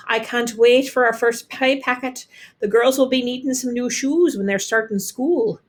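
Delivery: plain reading voice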